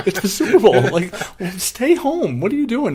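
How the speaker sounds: high, loud, laughing disbelief; mock outrage at friend skipping the game; exasperated retelling. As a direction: plead with your friend, half laughing. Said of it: "I'm like".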